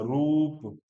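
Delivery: "रूप" drawn out, roop; long vowel. Shown as a demonstration. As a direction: stretch the oo in "रूप". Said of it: "इसको हमें बड़ा करके बोलना है".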